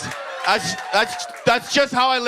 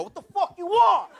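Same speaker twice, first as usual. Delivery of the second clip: rude New Yorker; 10 semitones higher than usual. Retold or imitated. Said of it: "they don't go".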